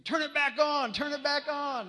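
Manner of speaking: high, loud, panicked yell; screaming. Retold or imitated. Soldiers under attack beg for the music back, screaming. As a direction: scream it as if a bomb just went off. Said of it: "they all started screaming".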